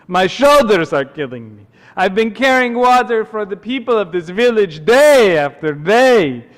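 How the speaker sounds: put-on loud kvetching water carrier with an accent; Dracula-like accent. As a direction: complain loudly in a thick put-on accent. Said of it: "Kinda sounds like Count Dracula".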